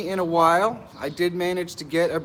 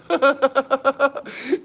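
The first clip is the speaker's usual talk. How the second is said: high bright giggle; real laugh; 10 semitones higher, 4 dB louder than usual. Real, not acted. Laughing at a viewer's cheeky comment. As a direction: laugh at the chat's joke.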